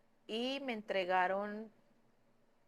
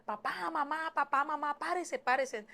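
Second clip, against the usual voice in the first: high-pitched urgent retold cry for help; retold shouting; 6 semitones higher than usual. Daughter running to wake her parents, retold urgent shouting. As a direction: call out as if rousing your parents, raised pitch. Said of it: "empecé a gritar".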